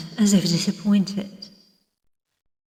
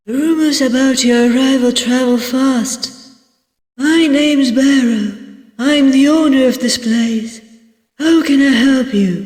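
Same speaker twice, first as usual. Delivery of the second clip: higher, louder character voice for an innkeeper; welcoming innkeeper; 5 semitones higher, 4 dB louder than usual. Acted. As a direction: greet a new guest in a raised, lively host voice. Voices innkeeper Barrow in a story.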